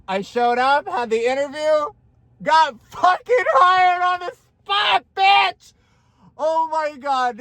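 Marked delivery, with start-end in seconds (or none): 2.4-5.7 high, loud victory yell; real triumphant yell; triumphant shout. Real, not acted. Triumphant shout about being hired. 6.4-7.4 high, slow breathless awe; real stunned delight; overwhelmed awe. Real, not acted. Overwhelmed by the news.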